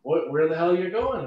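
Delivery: mock irritated customer; irritated demand. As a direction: push it back at him, fed up. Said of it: "He made me start getting irritated".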